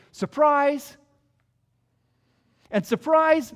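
spring it on them like a reveal; exclaimed surprise. Retold or imitated. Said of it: "he's saying".

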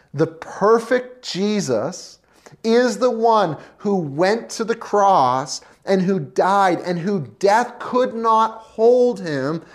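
normal talking voice

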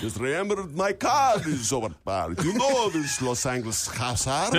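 slurred Hungarian vampire mumble; Bela Lugosi accent. Shown as a demonstration. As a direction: run the mumbled line at speed. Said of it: "say it real speed again".

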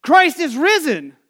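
slow, loud Easter call; energetic call. Shown as a demonstration. Pastor leading the congregation's Easter call-and-response, loud. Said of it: "you'll catch on really quick here".

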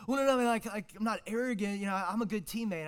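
put-on defensive teen. Kid protests he's not arrogant.

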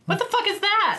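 high, disbelieving question; incredulous. Real, not acted. Guest baffled by cohost's app in high disbelief. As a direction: jump way up in disbelief.

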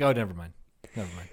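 low, furtive backing off, fading out; mock-secretive mumble; shady brush-off. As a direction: drop it quietly as if hiding something. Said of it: "act real shady".

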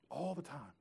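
dropping the volume on the repeat. Real, not acted. Preacher repeats it softly to the congregation. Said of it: "repeats the phrase, fading".

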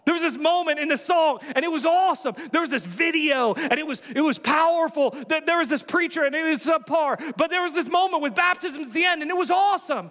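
a churchgoer gushing about Sunday, lightly hammed-up; excited churchgoer. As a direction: rave about the service like a thrilled fan. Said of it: "you go".

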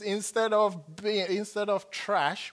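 normal talking voice

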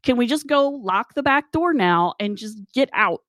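high-pitched acted pleading; exasperated plea. Pleading with Gary to lock up and leave, exasperated. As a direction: beg to get out of there.

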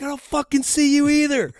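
very high, very loud retold yell; screaming back. Driver answering the fighter on the phone, screaming back. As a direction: re-enact yelling right back at him. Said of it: "I'm screaming at him".

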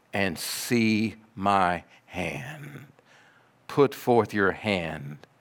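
low, very slow, measured words; gentle invitation. Acted. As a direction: softly invite the doubter closer. Jesus gently invites doubting Thomas.

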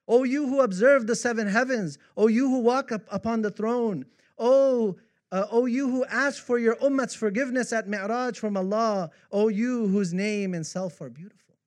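high, loud devotional declamation; declaimed praise poem. Acted. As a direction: call out each line of praise with full voice.

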